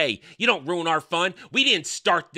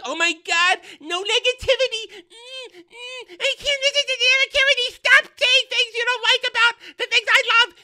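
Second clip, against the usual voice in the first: much higher, fast, mocking falsetto; exaggerated high-pitched mockery of an offended fan; mock whiny fan; 5 dB louder than usual. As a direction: whine in a prissy high voice to mock them.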